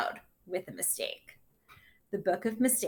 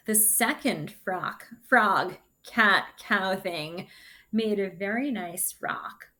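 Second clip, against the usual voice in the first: put-on reading to children. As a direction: read it gently to the class, letting each page land. Teacher reads picture book aloud.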